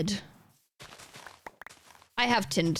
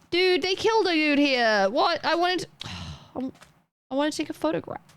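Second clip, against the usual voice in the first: dismayed whine; 6 semitones higher than usual. Real, not acted. Dismayed that the mobs are gone.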